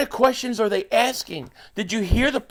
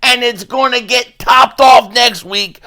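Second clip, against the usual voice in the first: loud, punchy rant opener; loud sports-rant intro; hyped-up shout; 7 dB louder than usual. Real, not acted. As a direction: blast it like a sports hot take. Opening hype rant, shouting.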